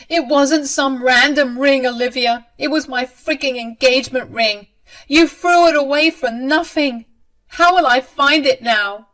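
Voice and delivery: loud, high, indignant outburst; upset protest. A narrator's reading. Bride protests the lost ring.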